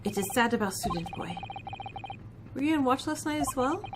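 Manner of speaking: put-on a proud Russian crewman, heavy accent; Stroganoff, Russian accent.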